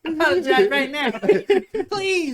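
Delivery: high, loud comic screech; Chris Tucker. Acted. Mocking a friend's story of demanding apology, high comic pleading. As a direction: demand it in a high-pitched, laughing squeal. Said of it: "Shut up, Chris Tucker".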